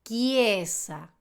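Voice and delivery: "chiesa" slowly, ch as hard k; Italian pronunciation. Shown as a demonstration. Teacher giving an Italian example, "chiesa" with k sound. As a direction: say "chiesa" as kye-za, drawn out. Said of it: "Veamos más ejemplos".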